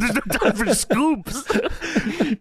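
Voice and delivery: high, drawn-out comic cry; mock yell. Co-host echoing fleeing wrestler, slow high mock yell. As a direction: stretch it out in a high mock panic.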